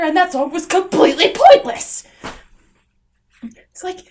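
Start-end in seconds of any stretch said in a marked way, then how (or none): 3.4-4.0 whisper. Real, not acted. Whispered aside.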